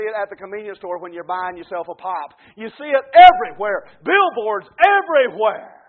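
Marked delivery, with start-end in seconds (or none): 2.6-5.8 loud, raised preacher's cry; emphatic preaching. Real, not acted. Preacher decrying what is seen on every hand, emphatic shout. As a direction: punch it out to the whole congregation.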